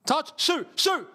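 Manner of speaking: rising shouts of shoot; urging the player to shoot, voice climbing; excited yell. Real, not acted. Fan yelling at the player to shoot, excited shout. Watch-along, shot on goal.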